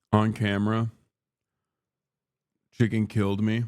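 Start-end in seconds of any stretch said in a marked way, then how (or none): none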